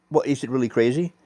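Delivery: ordinary delivery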